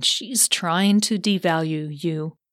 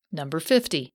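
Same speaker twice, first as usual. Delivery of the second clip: usual speaking voice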